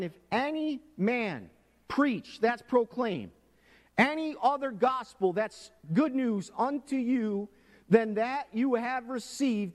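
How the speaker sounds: high, loud pulpit preaching, slowing on key words; fervent sermon delivery; preaching at full force. Real, not acted. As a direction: thunder the verse at the congregation. Preaching the quoted verse.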